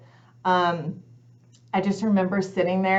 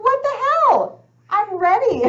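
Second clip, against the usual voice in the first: very high, loud mock outrage; exasperated retelling; 9 dB louder than usual. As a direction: protest to God in comic indignation. Retold exasperated complaint to God.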